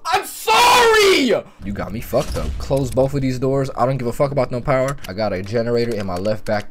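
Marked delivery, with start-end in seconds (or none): 0.1-1.4 very high loud scream, slow wailed sorry; real fright scream; terrified shriek then apology. Real, not acted. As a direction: shriek then beg for mercy. Jump scare mid-threat.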